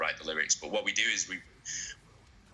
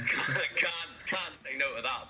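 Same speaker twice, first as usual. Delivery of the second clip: excited; 7 semitones higher, slower than usual. Retold or imitated. Excited quote about a legendary tour.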